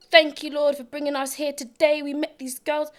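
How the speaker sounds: mock fervent prayer, pushed loud at the start; praying aloud. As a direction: imitate an earnest public prayer, opening loudly. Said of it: "she starts praying".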